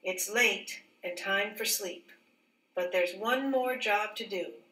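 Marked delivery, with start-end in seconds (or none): none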